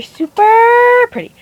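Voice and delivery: sudden loud, high, slow "super pretty"; loud burst. Real, not acted. Makeup vlogger suddenly blasting her viewers' ears. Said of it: "blew out your ears".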